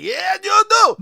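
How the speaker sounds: high, loud mock yell; imitating a yell. Rhea yelling at Asuka, a hammed-up shout. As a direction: yell it right in her face. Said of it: "she's on her greatest Mister Fuji impression".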